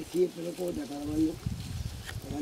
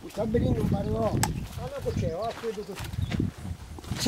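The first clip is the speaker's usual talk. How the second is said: normal talking voice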